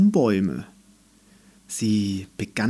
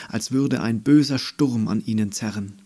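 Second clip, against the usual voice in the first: plain reading voice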